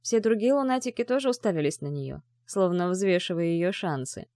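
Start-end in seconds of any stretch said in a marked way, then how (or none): none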